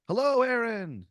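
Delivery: high, slow, loud cheerful hail; creature shouting hello. Acted. Flying creature hails Aaron from above, high slow shout. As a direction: greet him from the sky as you float past. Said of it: "one of them shouts".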